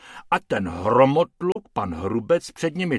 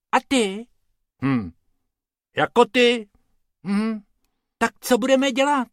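much higher, small worried rabbits; small rabbit voices; 11 semitones higher, 6 dB louder than usual. Acted. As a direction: chatter anxiously by the river. Rabbits' dialogue.

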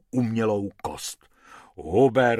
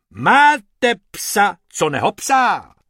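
louder, pumped-up advert voice; ad pitch; 6 semitones higher, 8 dB louder than usual. A narrator's reading. Hubert performing the dog-treat advert for the rabbits, pumped-up louder ad read. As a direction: puff up and sell it. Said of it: "nafoukl se a spustil".

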